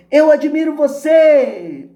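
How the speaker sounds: loud, high, drawn-out holler; slow, full-voiced call into the distance; father's shout. A narrator's reading. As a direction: shout praise out to the mountain. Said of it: "o pai grita em direção à montanha".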